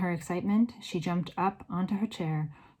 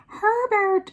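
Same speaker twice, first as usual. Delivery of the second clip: very high, loud shriek for a husband; shrill screaming; 8 dB louder than usual. A narrator's reading. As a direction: scream the name in panic. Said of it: "it was screaming".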